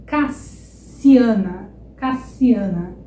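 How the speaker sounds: "Cassiana" very slow, ca-ssi-a-na. Shown as a demonstration. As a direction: stretch "Cassiana" with a sharp s. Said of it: "som do S S também é som de S". ("Cassiana" bem devagar com s)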